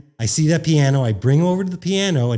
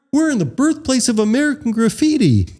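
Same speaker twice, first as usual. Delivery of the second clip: lightly voiced Brian, higher, like a stage announcement; imitating Brian; 11 semitones higher than usual. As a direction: announce it to the crowd as Brian, pitched up. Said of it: "Brian goes".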